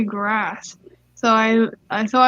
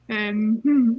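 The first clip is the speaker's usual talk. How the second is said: normal talking voice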